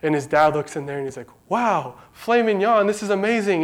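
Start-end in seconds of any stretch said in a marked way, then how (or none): 1.5-3.6 higher, delighted gasp of a thrilled father; a retold burst of joy at the groceries; dad thrilled over the gift of steak; excited dad. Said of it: "he's, like, so excited".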